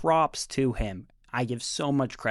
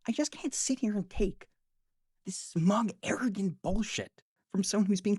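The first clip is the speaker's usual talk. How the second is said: pitched up high in exasperation; exasperated; 6 semitones higher than usual. Real, not acted. Ranting at the clip, pitched high and exasperated. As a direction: let it climb, fed up.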